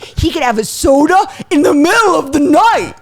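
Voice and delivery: high, loud gushing disbelief; amazed kid. Retold or imitated. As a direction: exclaim like a child awed by forbidden soda. Retold kids amazed by soda privilege.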